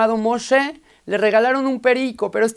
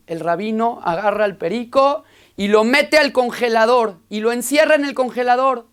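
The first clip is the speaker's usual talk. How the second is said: ordinary talk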